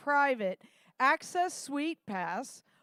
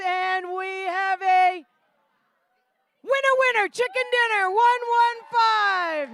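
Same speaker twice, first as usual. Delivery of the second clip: high, loud winner shout; excited announcement; 6 semitones higher, 6 dB louder than usual. Real, not acted. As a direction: crow out the winner.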